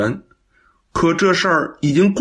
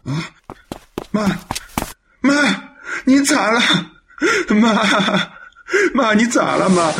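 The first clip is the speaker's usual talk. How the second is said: panicked calling; 6 semitones higher than usual. Acted. Son finds mother fainted.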